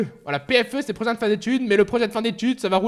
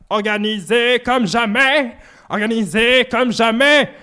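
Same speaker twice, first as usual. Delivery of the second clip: slow, stretched put-on voice; mock grandiose voice; slower than usual. Streamer repeating his own boast in a slow put-on voice. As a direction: savor it like a pompous slogan.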